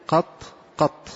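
"قط" closed on a still final ط; sukun ending. Shown as a demonstration. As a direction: say "قط، قط" with no vowel after the ط. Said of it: "بالتسكين".

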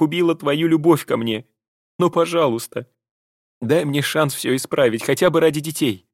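high, tearful, begging woman's voice; tearful pleading. A narrator's reading. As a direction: plead through swallowed tears. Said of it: "Таня прошептала, глотая слёзы".

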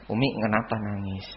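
soft, low question to mother; gentle question. Shown as a demonstration. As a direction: ask mum very gently, voice down. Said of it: "pertanyaan yang tepat itu seperti itu". (bertanya pelan dan lembut kepada Umi)

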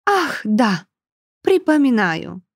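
mock-remembering, theatrical lady. A narrator's reading. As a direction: play it up for show. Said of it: "картинно прижала ладонь ко лбу". (театрально-жеманно)

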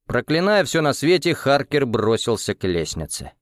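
plain reading voice

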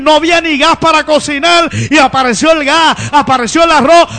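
fervent Pentecostal preaching, loud and driving; preaching at full force. Acted. Preacher rousing the congregation about finances, full-force preaching.

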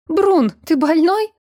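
sputtering shout, high and loud. A narrator's reading. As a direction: yell it out while thrashing in water. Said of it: "выкрикнула она".

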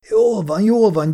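high, loud squealing surrender; whimpering squeal. A narrator's reading. A skinny man squealing as his arm is twisted.

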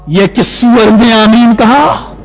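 slow, loud chanted demand; imitating furious imam. Imam demanding who said amen, loud singsong fury. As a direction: carry the salam's intonation straight into an outburst. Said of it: "بالکل یہی style".

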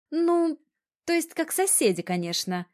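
high, flustered backpedalling; hasty correction. A narrator's reading. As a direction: blurt the correction high, then settle. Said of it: "поспешно добавила". (поспешная поправка, высоким голосом)

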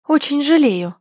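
courteous little apology; politely sorry, with restraint. A narrator's reading. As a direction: keep it civil and formal. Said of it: "учтиво продолжает Соня".

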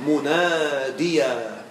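very slow "مناديا", ending on a long aa; pausal alif in recitation. Shown as a demonstration. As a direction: stretch each long vowel of "مناديا". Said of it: "إذا وقفت".

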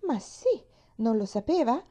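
high, eager burst; impulsive enthusiasm. A narrator's reading. Girl assuring the woman she is pretty, eager. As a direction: blurt it out eagerly with lifted pitch. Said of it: "disse di slancio Pollyanna".

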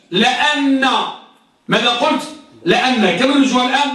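usual speaking voice

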